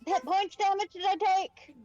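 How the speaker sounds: ordinary delivery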